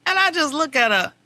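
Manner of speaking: ordinary talk, nothing marked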